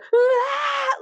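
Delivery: sudden high-pitched mock scream; cartoonish yelp of bugging out; freak-out scream. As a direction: burst into a comic panicked shriek.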